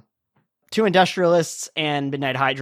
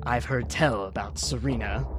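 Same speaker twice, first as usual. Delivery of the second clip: ominous low; 4 semitones lower, 6 dB quieter than usual. Acted. GM voicing Ulrich, strained NPC.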